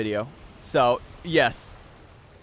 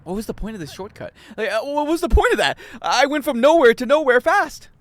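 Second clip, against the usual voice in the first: exasperated rant; 9 semitones higher, 6 dB louder than usual. Real, not acted. Frustrated rant at shortcut.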